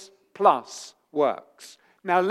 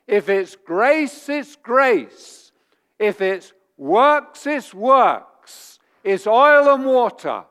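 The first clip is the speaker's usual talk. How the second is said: emphatic; 5 semitones higher, 6 dB louder, slower than usual. Real, not acted. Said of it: "preacher building to a point".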